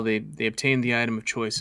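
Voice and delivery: ordinary talk, nothing marked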